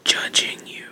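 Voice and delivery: playful, hushed whisper; mock judgmental whisper. Host teasing the email writer after the letter, mock whisper.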